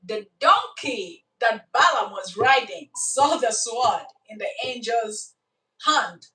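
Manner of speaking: normal talking voice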